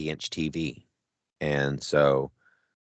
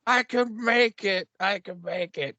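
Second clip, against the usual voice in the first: high, strained, straining through the pain; imitating the hurt guy from Predator; pushing himself to carry on, high and strained; Predator log guy; 13 semitones higher than usual. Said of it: "That's how I was doing".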